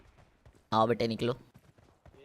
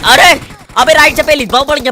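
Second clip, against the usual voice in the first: shouted command; 7 semitones higher, 7 dB louder than usual. Real, not acted. Urging teammate in fight.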